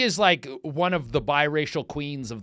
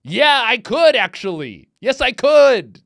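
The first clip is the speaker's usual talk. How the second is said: sarcastic retort; 9 semitones higher, 12 dB louder, slower than usual. Real, not acted. Sarcastic answer to movie's question.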